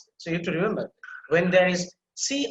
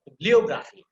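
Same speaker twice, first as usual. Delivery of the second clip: "Bibliography" punched louder on the O; stress on O; 2 semitones higher, 6 dB louder than usual. Shown as a demonstration. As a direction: hit the O sound in "Bibliography". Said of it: "you have to put emphasis there".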